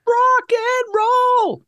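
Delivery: high, drawn-out rocker yell; rock and roll shout. Acted. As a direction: belt it out like a metal fan. Metal joke, drawn-out cry.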